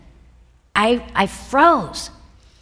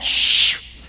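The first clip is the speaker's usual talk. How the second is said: imitated email whoosh; long airy "shh" like mail flying off; whooshing send noise. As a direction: mimic the sent-mail swoosh. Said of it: "you know that noise".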